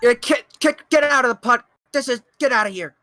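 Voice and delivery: loud, high get-out shout; shooing yell. Real, not acted. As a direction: chase the cat off. Shooing Rosa out loudly.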